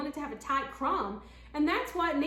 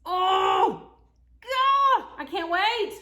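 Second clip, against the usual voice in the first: much higher, loud outburst; excited; 6 semitones higher, 11 dB louder, much slower than usual. Real, not acted. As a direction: burst out with craving. Craving the biscuit.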